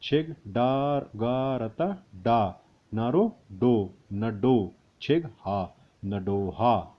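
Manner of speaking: slow syllables; slow spelling out. Shown as a demonstration. Teacher spelling letters slowly for students. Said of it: "अगला देखिए".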